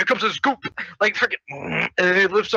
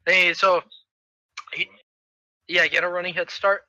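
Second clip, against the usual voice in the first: ordinary talk, nothing marked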